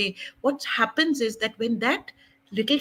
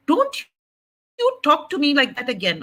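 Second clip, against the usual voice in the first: retold indignant outburst, high on the first word; holy anger; 5 semitones higher, 4 dB louder than usual. Believer telling off the devil in retold holy anger. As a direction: open with a high, sharp "Don't", then scold. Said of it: "I got that holy anger".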